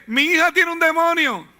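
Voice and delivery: high, loud desperate plea; desperate cry. Acted. Mother crying out to Jesus for her daughter, loud and high. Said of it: "Y le dice".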